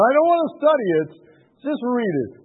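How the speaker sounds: high, loud retold objection; impatient refusal. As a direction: cut off the suggestion, imitating impatience.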